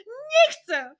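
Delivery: "Nie" shouted high and loud, falling; tearful shout. Acted. Jasiek refusing the phone, loud high tearful shout. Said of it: "krzyknął rozżalony Jasiek".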